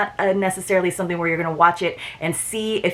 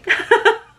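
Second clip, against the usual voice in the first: a squeaky laugh at her own attempt; high burst of laughter; 3 dB louder than usual. Real, not acted. Laughing at her own try at French. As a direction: giggle breaking out high.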